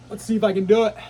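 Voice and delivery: loud, self-encouraging; rally yourself to keep going; psyched up. Real, not acted. Psyching up in cold water.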